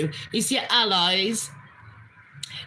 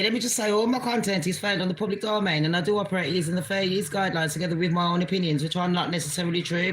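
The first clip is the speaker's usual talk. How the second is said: rushed disclaimer; 3 semitones lower, faster than usual. Real, not acted. Vlogger rushing through a fair-use disclaimer, fast and low-key. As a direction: rattle off the small print quickly. Rapid legal disclaimer read.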